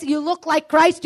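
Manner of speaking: ordinary delivery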